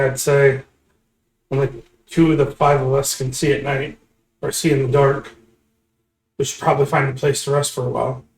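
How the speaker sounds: ordinary delivery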